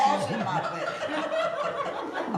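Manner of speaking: high, slow, through laughter; giggling out the excuse; laughing protest. Real, not acted. Laughing defending herself in story.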